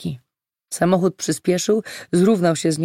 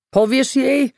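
higher, louder shouted question; called after her; 8 semitones higher, 5 dB louder, slower than usual. A narrator's reading. Man shouts after Olga as she leaves, anxious-to-know call. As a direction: call it out to someone walking away. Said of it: "zawołał za nią".

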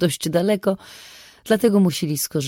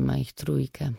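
plain reading voice

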